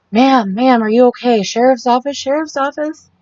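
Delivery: high, urgent imitated call; sheriff calling out. Sheriff calling to a woman hiding in the bathroom, imitated high call-out. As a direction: call out to someone inside, imitating an officer.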